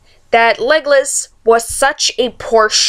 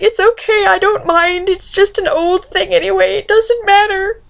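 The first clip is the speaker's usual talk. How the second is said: high, mock-weepy reassurance; hammed-up high tearful voice, breaking into a wail; tearful crying; 8 semitones higher than usual. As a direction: act out crying while insisting it's fine. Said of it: "starts crying".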